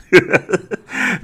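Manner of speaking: high, loud laugh at himself; embarrassed laugh. Real, not acted. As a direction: burst out laughing at the mistake. Laughs at his own slip.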